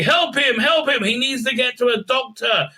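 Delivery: high, hammed-up cry for help; mock urgent plea. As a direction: call for help over a casualty. Acted plea after a man is knocked out.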